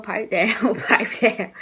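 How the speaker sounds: laughing through pipe down; can't keep a straight face telling her off; amused laugh at a roommate's outburst. Retold or imitated. Laughing at roommate mid-argument.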